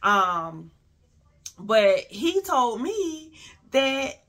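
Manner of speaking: ordinary talk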